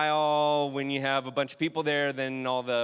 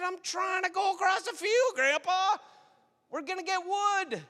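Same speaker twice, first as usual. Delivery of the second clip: high shout across a snowy field; boy shouting. Retold or imitated. Young Jason shouting to grandpa across the field. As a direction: yell it out to grandpa far ahead.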